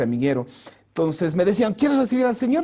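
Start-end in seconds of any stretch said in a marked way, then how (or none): 1.7-2.6 high, bright mock church invitation; hammed-up eager asking. Imitating the Christians asking if he'll receive the Lord, mock eager.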